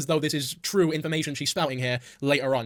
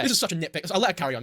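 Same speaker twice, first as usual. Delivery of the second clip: normal talking voice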